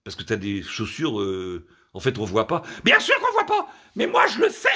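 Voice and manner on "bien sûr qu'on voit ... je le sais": high, loud mock-female squeal; indignant woman. Woman defending her hidden pedicure, indignant squeal.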